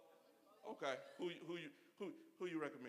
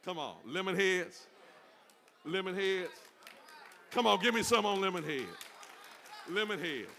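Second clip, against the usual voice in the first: excited hype; 6 semitones higher, 9 dB louder than usual. Real, not acted. Hyping his favorite candy.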